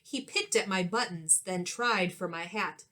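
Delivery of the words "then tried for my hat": plain reading voice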